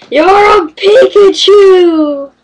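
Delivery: high, loud, drawn-out cry; playful mock-dramatic cry. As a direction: cry it out like a grand reveal. Mock reveal, crying out to Pokémon.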